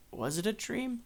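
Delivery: soft, high, rising wonder; wondering question. Retold or imitated. Old man seeing food prepared for him, softly wondering. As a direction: lift it into a puzzled question.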